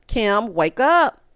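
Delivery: normal talking voice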